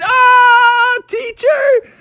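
high-pitched wailing tattle to the teacher; cartoonish outraged squeal; wigged out. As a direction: wail it out, drawn out and very high. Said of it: "wigged out and he said".

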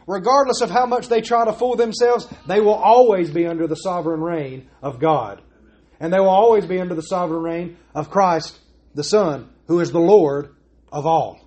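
normal talking voice

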